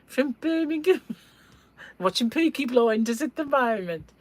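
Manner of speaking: high, put-on Brummie accent; sing-song Brummie impression; Birmingham accent. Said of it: "I had this friend from".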